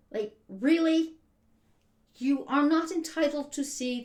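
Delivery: exasperated. Real, not acted. Exasperated at entitled tourist.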